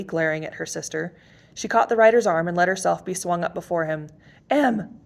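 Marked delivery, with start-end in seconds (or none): 4.5-5.0 high, loud cry of alarm; panicked shout. A narrator's reading. Sister shouting as Emily rides off with a stranger, panicked. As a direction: yell her name as she's carried off. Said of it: "shouted Kate, panicked".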